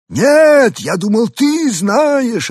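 high, slow alien reply; drawn-out alien answer. A narrator's reading. Arthur's alien voice. (высокий протяжный голос пришельца)

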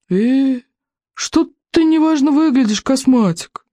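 high, teasing Bertha voice; mock-polite joke. A narrator's reading. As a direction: needle him with fake politeness, pitched high. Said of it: "вежливо пошутила Берта". (высоким голосом, с притворной вежливостью)

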